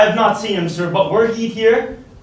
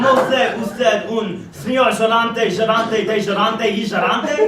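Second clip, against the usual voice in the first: hammed-up Spanish accent with a laugh. Mock Spaniard demanding the master, in a Spanish accent. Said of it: "another one is coming".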